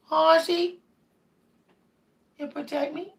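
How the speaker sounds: high coo, dropping very soft; sweet pet voice, almost whispered. Real, not acted. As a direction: coo tenderly at the cat. Cooing to her cat.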